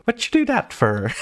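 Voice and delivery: high-pitched, hammed-up mock accent; V go's accent, badly imitated. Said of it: "horrible rendition of your accent".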